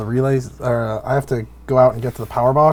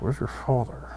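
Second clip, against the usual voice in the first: quiet, cold question across the table; a hushed, icy dig; pointed quiet question; 12 dB quieter than usual. Acted. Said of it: "staring at Cassandra like".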